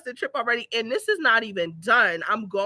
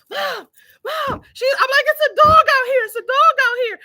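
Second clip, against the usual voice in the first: high, loud panicked calling for mom; frantic yell; 14 semitones higher, 8 dB louder than usual. Retold or imitated. Locked out, yelling for her mom to open the door, panicked. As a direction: scream for her to hurry up.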